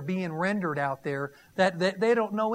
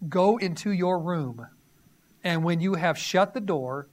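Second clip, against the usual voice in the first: firm, louder reading of scripture; 3 semitones lower, 3 dB louder than usual. Acted. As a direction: proclaim the verses to the congregation. Reads Matthew six aloud.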